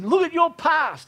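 high, forceful mock accusation; accusing devil. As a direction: hurl it as the devil's taunt. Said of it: "the devil has accused you".

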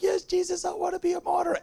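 high-pitched imitation of weeping worshippers; hammed-up sobbing altar-call plea; mock weeping. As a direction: wail it tearfully, sending them up. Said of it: "they're weeping".

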